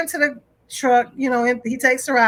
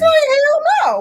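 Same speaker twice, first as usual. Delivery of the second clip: much higher and louder flat refusal; indignant refusal; 12 semitones higher, 4 dB louder than usual. Retold or imitated. Father refuses the manager's demand, loud and indignant. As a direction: shut it down, outraged.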